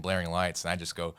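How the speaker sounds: ordinary talk, nothing marked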